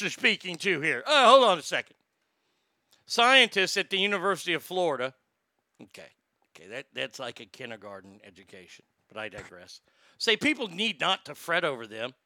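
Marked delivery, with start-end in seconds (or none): 5.8-9.8 low, soft sarcastic aside; snide aside. Real, not acted. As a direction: mutter a snarky side comment.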